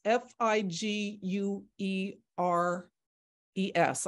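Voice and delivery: each letter of "F I G U E R E S" said slowly and separately; slow spelling of a surname; spelled out letter by letter. Shown as a demonstration. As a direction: spell out "F I G U E R E S" one letter at a time. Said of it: "how you spell it".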